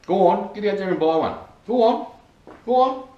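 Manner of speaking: high-pitched egging on; playful urging. Pretend. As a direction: shoo them along in a high, joking voice. Jokingly shooing viewers to buy.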